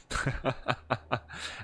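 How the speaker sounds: airy little chuckle to himself. Real, not acted. Laughs mid-list of topics.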